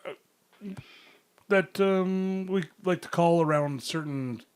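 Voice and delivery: high, hammed-up weed dealer pitch; salesman voice. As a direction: sell it like a smooth dealer.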